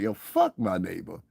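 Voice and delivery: low, soft grumble under the breath; muttered aside. Retold or imitated. Grumbling to himself about his neighbor, low and soft. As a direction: mutter it like a private thought. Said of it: "you like".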